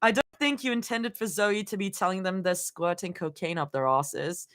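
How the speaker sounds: soft Australian attempt drifting toward RP; attempted Australian accent. Shown as a demonstration. Streamer reading a beta comment, attempted Australian accent. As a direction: read it carefully in a shaky-grasp Australian accent. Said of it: "Why are we in accent?".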